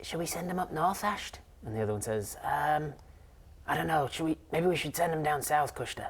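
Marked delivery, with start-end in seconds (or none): 0.0-1.4 lightly voiced fairy, higher and softer; a hushed, higher conspirator's question; half-whispered plotting; mock whispering. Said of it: "you hear them whispering to each other". 2.4-6.1 lightly voiced plotter, a little higher; hint of a hesitant conspirator; unsure reply in a lightly put-on voice; mock whispering. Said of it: "you hear them whispering to each other".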